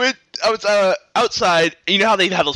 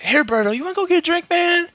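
calling out to friend; 12 semitones higher than usual. Retold or imitated. Calling out to Roberto drunk.

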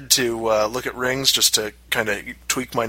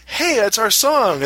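high, slow happy exclaim; light up at hearing the song; wife recognizes their song, high and delighted; delighted surprise; 9 semitones higher, slower than usual. Retold or imitated. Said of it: "she's like".

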